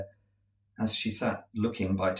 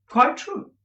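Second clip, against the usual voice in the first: high, surprised cool reply; cool surprise; 8 dB louder than usual. A narrator's reading. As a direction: answer lightly surprised. Estella surprised but cool.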